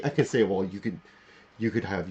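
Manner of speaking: usual speaking voice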